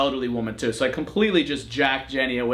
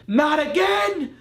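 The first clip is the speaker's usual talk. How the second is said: frustrated yell; 12 semitones higher, much slower than usual. Retold or imitated. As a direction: shout it like the deal just fell through again. Retold outburst over lost dog.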